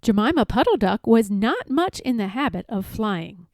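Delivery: ordinary delivery, nothing marked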